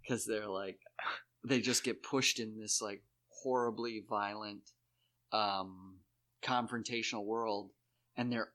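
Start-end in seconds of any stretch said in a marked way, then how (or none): none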